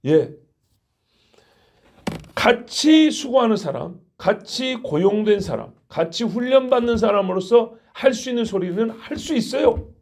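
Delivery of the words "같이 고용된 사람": usual speaking voice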